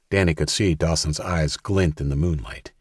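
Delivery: plain reading voice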